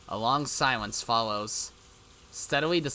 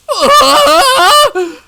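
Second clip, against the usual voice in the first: high, loud hysterical cackle; unhinged laugh far higher and louder; 18 dB louder than usual. Acted. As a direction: laugh like she's snapping. Mion breaks down over Rika.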